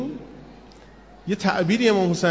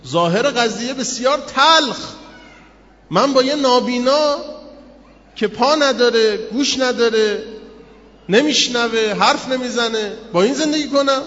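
higher, louder, slow lament in a changed voice; anguished complaint; 6 semitones higher, 4 dB louder than usual. Acted. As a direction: plead against a bitter fate. Voicing the tested man's lament.

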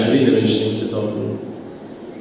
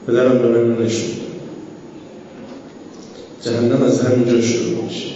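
normal talking voice

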